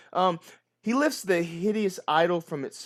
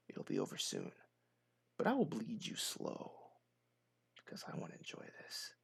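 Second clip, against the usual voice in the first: low, hushed, breathy threat with a knife at the throat; menacing villain whisper; 3 semitones lower than usual. Acted. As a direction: savour every word like a gloating cultist. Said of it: "I gave him a different voice then".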